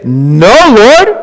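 loud refusal rising high on Lord; pleading refusal. Retold or imitated. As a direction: cry out the refusal, voice leaping up. Peter refusing, acted quote.

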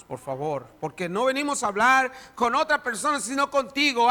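prayer swelling to loud preaching; fervent prayer. Acted. Pastor praying aloud over the congregation, fervent prayer. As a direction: pray with growing fervour.

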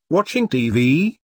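doubting rising question; skeptical. A narrator's reading. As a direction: ask it in disbelief. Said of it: "Blake said skeptically".

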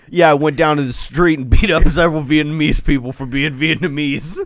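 loud Boston tough-guy voice; Mark Wahlberg. Retold or imitated. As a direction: play a loud Boston bro bragging. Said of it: "Oh, Mark Wahlberg".

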